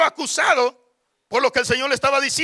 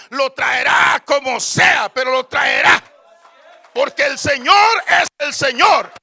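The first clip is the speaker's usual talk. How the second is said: emphatic preaching; 3 semitones higher, 5 dB louder than usual. Real, not acted. Preacher insisting God will bring them back, emphatic preaching. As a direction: declare it with full conviction.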